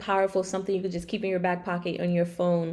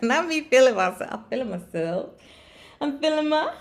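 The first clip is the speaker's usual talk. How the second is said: sassy, louder and higher; feeling myself; 6 semitones higher, 4 dB louder than usual. Real, not acted. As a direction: show off your confidence playfully. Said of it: "So now that I have the raspy voice".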